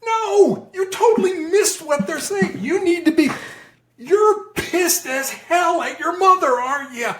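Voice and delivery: very high, impassioned outburst; match their ranting, pitch right up; mirrored yelling. Shown as a demonstration. Negotiator mirroring someone raging about their mother, heated. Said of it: "ranting and raving and yelling and screaming".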